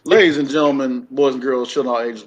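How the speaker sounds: loud showman's welcome; ringmaster. Pretend. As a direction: call out to the big top crowd. Opening the show like announcer.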